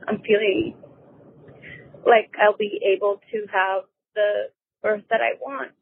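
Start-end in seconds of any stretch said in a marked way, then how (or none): none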